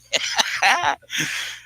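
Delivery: high, falling giggling fit; a helpless giggle spilling out. Real, not acted. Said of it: "don't get me started giggling again".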